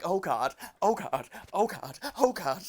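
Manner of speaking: lightly voiced panicky teenage girl; mock fluster, a touch of panic; teenage girl imitation. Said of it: "these teenage girls".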